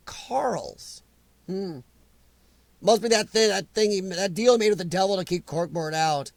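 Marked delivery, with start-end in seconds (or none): 0.1-1.8 whispered conspiratorial aside. Real, not acted. Whispering to chat about a suspicious notification, whisper. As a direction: lean in and whisper it like a secret.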